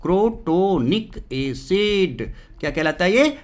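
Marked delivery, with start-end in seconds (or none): 0.0-2.3 teacher spelling out Crotonic acid by ear; very slow drawn-out answer. Shown as a demonstration. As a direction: stretch every syllable of Crotonic. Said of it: "क्या बोलते हैं इसको?".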